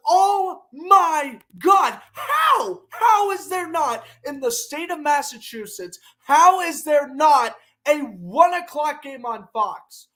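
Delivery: loud, exasperated shouting at the TV; enraged outburst. Real, not acted. Vlogger furious he cannot watch his team, shouting. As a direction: rant in real disbelief, voice jumping high. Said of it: "I'm just so enraged".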